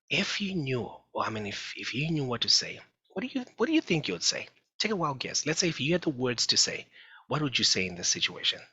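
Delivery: coaxing parent. Retold or imitated.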